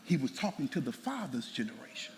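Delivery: hushed whisper for emphasis. Real, not acted. Preacher whispering the point to the congregation.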